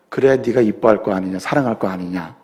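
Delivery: God gently answering the husband; God's reply. Acted. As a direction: speak as God, low and hushed. God answers Adam in joke.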